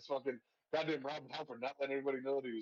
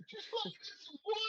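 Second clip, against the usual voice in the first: She's floating in some water said much higher and softer; mock dramatic; 4 dB quieter than usual. Shown as a demonstration.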